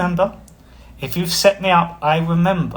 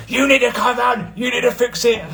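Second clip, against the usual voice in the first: high mock shout at full blast; hammed-up angry shouting woman; imitating angry housemate; 10 semitones higher than usual. Imitating an angry housemate demanding a fix, mock shout. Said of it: "Angry patriot".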